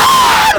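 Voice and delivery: high, loud scream; sudden full-voiced shriek; startled scream. Real, not acted. Gamer jolted by the horror game, screaming. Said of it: "Napapasigaw ako sa larong to".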